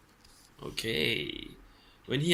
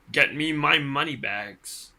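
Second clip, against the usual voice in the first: louder, bossy giant demand; shouted order; 2 semitones higher, 7 dB louder than usual. A narrator's reading. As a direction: bark it like a giant.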